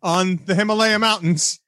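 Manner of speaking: high, slow send-off; mock grand farewell. Man setting his yak free, mock grand farewell. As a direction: announce it high and drawn out.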